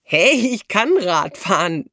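slow, drawn-out mock-offended defence; playfully indignant; amused protest. A narrator's reading. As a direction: defend yourself good-humouredly. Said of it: "wehrte der Junge sich lachend".

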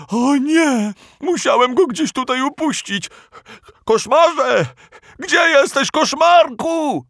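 high, loud frantic calling; panicked shouting. Acted. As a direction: call out in panic. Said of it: "wykrzyknął stwór".

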